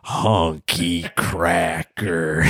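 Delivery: slow, low growling yell; cranky old man. Acted. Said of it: "my famous character".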